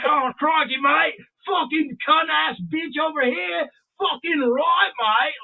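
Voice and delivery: high, hammed-up Aussie accent; full-blown Australian. Speaker showing his brother full-blown Australian, hammed-up accent. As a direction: go over-the-top Australian, high and drawn out. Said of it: "I would be full-blown Australian by now".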